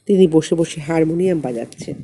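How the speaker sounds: plain reading voice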